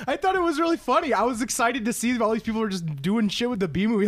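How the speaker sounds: high-pitched, gushing enthusiasm; really excited. Real, not acted. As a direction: rush it out with giddy delight. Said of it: "[name]'s really excited about the B movie".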